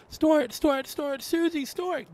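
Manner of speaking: very high squeaky piggy snorts; high pig voice snorting between words. A narrator's reading. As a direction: squeal it out like a startled piglet. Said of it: "gasped Peppa".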